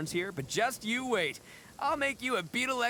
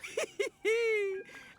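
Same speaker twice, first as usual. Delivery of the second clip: big booming laugh, higher and bold; hearty laugh. Acted. Gang boss laughing after accepting a duel, hearty laugh.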